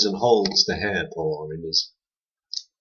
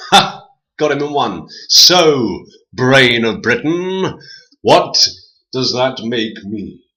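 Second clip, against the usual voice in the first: loud, slow teasing; booming boulder; 3 semitones higher, 5 dB louder, slower than usual. A narrator's reading. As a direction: boom it out with a laugh.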